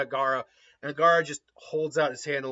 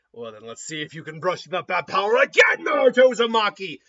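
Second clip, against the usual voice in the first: villain challenge; 3 semitones higher, 8 dB louder than usual. Acted.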